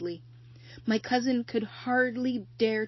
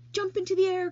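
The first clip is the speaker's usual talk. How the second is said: high, loud cry of an order; 11 semitones higher, 7 dB louder than usual. Acted. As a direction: call out the command with energy. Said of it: "cried Charlotte".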